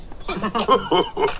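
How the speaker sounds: Daddy Pig's belly chuckle; jolly chuckle. A narrator's reading. Daddy Pig replies that he's hungry, chuckling.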